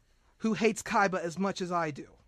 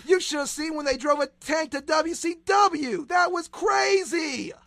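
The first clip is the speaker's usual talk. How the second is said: excited; 6 semitones higher, 5 dB louder than usual. Real, not acted. Hyped recalling DX tank.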